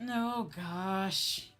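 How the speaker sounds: usual speaking voice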